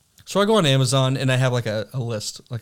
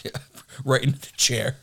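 usual speaking voice

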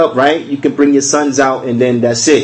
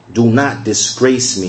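ordinary delivery

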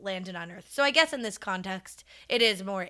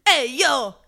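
loud, higher Italian-American holler; East Coast Italian-American; 4 semitones higher, 11 dB louder than usual. Acted. Guessing at East Suffolk accents for the co-host, Italian-American. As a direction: give it a New Jersey Italian accent. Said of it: "You sound Italian".

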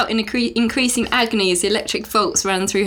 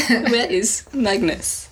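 a slow, laughing attempt at a Russian accent; much slower than usual. Shown as a demonstration. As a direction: copy a Russian accent slowly while giggling. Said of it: "do your one of Victor, and then I'll copy it".

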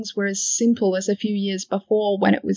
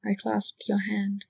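ordinary delivery

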